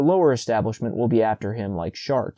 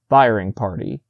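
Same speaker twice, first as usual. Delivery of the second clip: usual speaking voice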